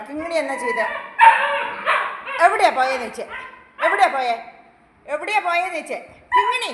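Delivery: loud, high pretend interrogation; mock scolding. Owner pretend-scolding the pet to make it laugh. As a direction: demand an answer, sternly playful. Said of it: "വഴക്ക് പറയുമ്പോഴേ ചിരിക്കുള്ളൂ".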